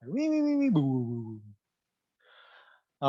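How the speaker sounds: imitated ambulance siren. Shown as a demonstration. Lecturer demonstrates the Doppler effect with an imitated ambulance siren. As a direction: mimic a passing siren, pitch falling away. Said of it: "you hear it at a lower pitch".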